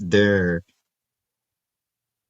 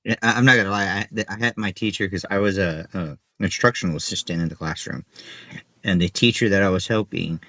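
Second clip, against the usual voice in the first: normal talking voice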